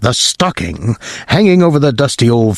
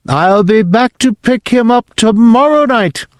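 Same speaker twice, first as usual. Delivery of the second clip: coachman voice; 12 semitones higher than usual. Acted. Coachman leaving the boy.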